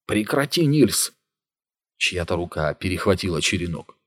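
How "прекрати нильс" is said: high shout to stop him. Acted. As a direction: call him off in alarm. Gunnar stops the attack.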